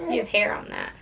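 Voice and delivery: ordinary talk, nothing marked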